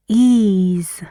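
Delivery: "ease" said loud and drawn out; stressed EASE. Shown as a demonstration. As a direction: punch out "ease" as one long stressed syllable. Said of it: "All the words have the stress on".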